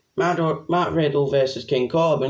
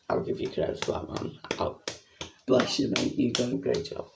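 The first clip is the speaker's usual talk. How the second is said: whisper; 3 semitones lower, 8 dB quieter than usual. Pretend. Praising the referee, aside.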